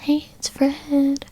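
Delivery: high, drawn-out catchphrase impression; imitating a YouTube character's slow, high intro; Fred. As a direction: stretch out a high-pitched greeting. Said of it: "but Fred, loved Fred".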